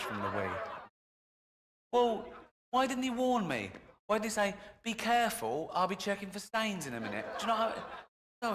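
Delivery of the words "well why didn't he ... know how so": indignant outburst. Real, not acted. Indignant rant about the doctor.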